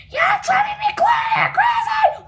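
high, loud furious scream; exasperated shout. Acted. Sister yelling at teasing brother, furious. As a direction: explode at him at the top of your voice.

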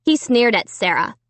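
ordinary delivery

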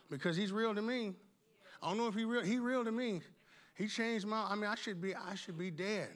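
ordinary talk

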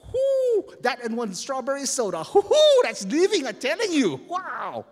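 loud high whooping joy; excited whoop. Real, not acted. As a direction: shout it out with glee. Excited about dinner on beach.

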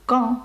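quand said with a nasal "an", no final d; French Quand with a nasal vowel and silent d. Shown as a demonstration. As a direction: say Quand as a clear nasal kɑ̃. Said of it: "Це носовий звук".

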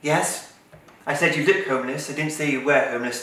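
ordinary talk

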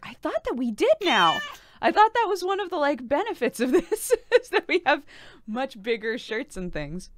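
mock indignant surprise. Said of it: "I was like".

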